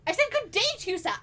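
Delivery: very high, loud mock British accent; huffy British otter, high and loud; British otter voice on request. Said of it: "Do it again".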